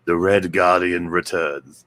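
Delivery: lazy American delivery of a Russian line; mock flat American read. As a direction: imitate an actor not trying the accent. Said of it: "you don't even try it".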